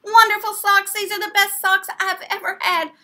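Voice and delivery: very high, loud joyful squeal; girl overjoyed with new socks; yelled with joy. Acted. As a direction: shriek with delight. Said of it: "and yelled".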